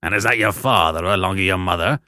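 gruff convict. Acted. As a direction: growl the order. Convict asks.

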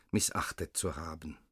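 ordinary delivery, nothing marked

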